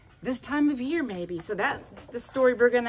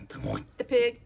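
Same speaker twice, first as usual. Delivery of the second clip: ordinary delivery, nothing marked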